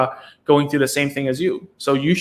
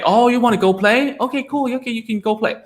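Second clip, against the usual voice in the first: higher, bright rising "Oh, you wanna go play?"; upbeat modeling reply, much higher; excited parent reply; 8 semitones higher than usual. Shown as a demonstration. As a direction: answer the child with delighted higher-pitched approval. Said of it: "much more natural to teach a kid".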